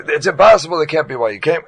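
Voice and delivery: loud incredulous objection; disbelieving shout. Retold or imitated. Philip disbelieving in the argument.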